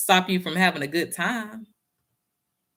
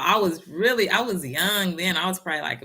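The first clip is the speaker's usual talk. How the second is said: ordinary delivery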